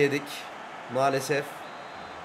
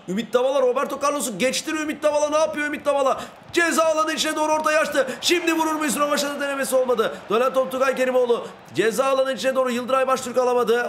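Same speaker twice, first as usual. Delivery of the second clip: excited match commentary, pitch climbing with the attack; live play-by-play; 5 semitones higher than usual. Pretend. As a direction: commentate the game as a rising TV call. (heyecanlı maç spikeri anlatımı)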